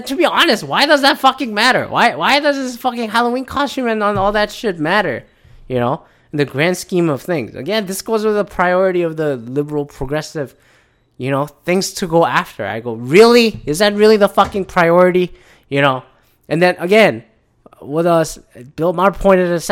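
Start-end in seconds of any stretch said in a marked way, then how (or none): 0.0-5.2 high-pitched exasperated rant. Real, not acted. Podcaster venting about Halloween costume outrage, exasperated. 12.9-15.3 louder, higher incredulous challenge. Retold or imitated. Podcaster challenging liberal progressives' priorities, incredulous. His retold reaction to outrage priorities.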